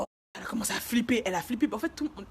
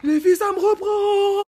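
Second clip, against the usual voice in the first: very high, drawn-out yell; retold scream; 13 semitones higher, 12 dB louder, slower than usual. Warning her friends it is back, acted high scream. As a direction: cry out to the girls, stretching the words. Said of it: "je commence à crier".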